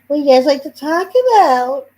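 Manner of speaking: high, loud mock Cody Rhodes voice. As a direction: sneer it up high like the champ on the mic. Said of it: "He's like".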